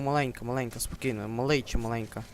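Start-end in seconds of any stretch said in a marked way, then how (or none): none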